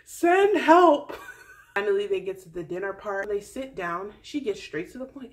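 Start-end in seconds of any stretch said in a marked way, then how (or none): 0.1-1.1 loud, high cry for rescue; hammed-up desperate call for help; mock plea for help. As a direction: yell it like a drowning woman. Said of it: "She literally was just".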